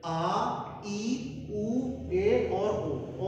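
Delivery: आ ई उ ए ओ said slowly and clearly, ओ stretched long; slow model vowels for students to copy; drawn-out vowel drill. Shown as a demonstration. Teacher models Japanese vowels slowly to the class. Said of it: "इसको क्या पढ़ेंगे?".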